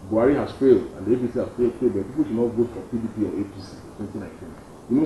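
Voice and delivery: ordinary talk, nothing marked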